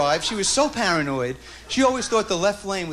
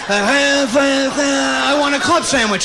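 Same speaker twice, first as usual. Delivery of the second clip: very slow, distorted yell; grandpa on TV; 4 semitones higher, 5 dB louder, much slower than usual. Retold or imitated. Grandson playing grandpa through the TV antenna, slow distorted shout. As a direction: drag it out like a garbled broadcast.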